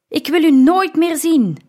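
loud, high furious cry; angry shout. A narrator's reading. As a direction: shout it in rejection. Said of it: "schreeuwde Dionysius". (woedend, hoog geschreeuwd)

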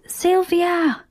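high calling out of a name; calling Sylvia across the beach, high; loud call. A narrator's reading. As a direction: call her name after the car. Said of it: "roept nogmaals luid".

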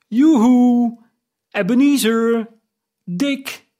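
high, loud hearty call; jovial full voice. A narrator's reading. Old master calling his apprentices, jovial and loud. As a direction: boom out a cheerful greeting to the apprentices. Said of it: "met een genoeglijke, zalvende, volle, vette, joviale stem".

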